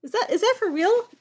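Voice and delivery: high, disbelieving question; can't believe the book says it; disbelief. Real, not acted. Reader puzzled by a typo, asks in high disbelief. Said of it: "reacting to odd word".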